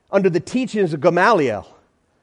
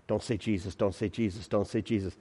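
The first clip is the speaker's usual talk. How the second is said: much softer hushed plea; pleading hush; 10 semitones lower, 13 dB quieter than usual. Acted. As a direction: beg under your breath. Pleading whisper before the answer.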